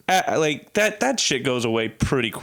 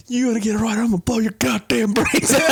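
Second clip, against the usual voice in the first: higher, louder self-threatening actor; DiCaprio scene impression; 9 semitones higher, 4 dB louder, faster than usual. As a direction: bark the threat at your own reflection. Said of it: "he's like".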